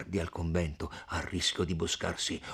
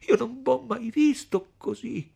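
high, tearful, loud distress; distraught, high-pitched weeping voice; sobbing; 13 semitones higher, 7 dB louder than usual. A narrator's reading. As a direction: force the words out through acted sobs. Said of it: "disse Lucia a stento tra i singhiozzi".

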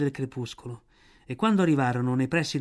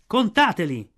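loud, higher, contemptuous cry; scornful shout; 5 semitones higher, 5 dB louder than usual. A narrator's reading. Mowgli hands the herd back to villagers, shouting scornfully. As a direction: fling the order out with scorn. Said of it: "gridò Mowgli sprezzante".

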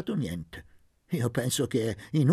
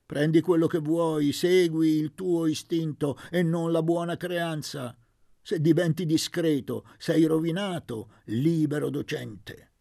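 eager urging; 5 semitones higher, 4 dB louder than usual. A narrator's reading. Don Benedetto esorta Luca.

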